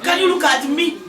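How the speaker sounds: ordinary talk, nothing marked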